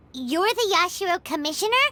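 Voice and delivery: ordinary talk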